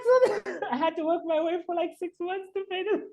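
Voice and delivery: high-pitched, laughter-squeezed punchline; laughing while talking. Real, not acted. Guest finishing his money disaster story, cracking up. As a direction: push the story out through real laughter.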